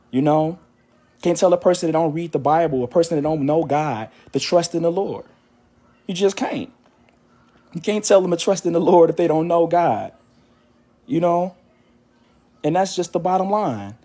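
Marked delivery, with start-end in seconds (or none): none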